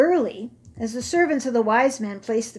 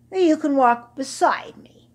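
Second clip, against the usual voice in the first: a touch of mock grandeur; regal voice; 5 semitones higher, 6 dB louder than usual. Old camel granting young camels a favour, mildly regal. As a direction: speak like a king granting favours. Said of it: "in his most regal voice".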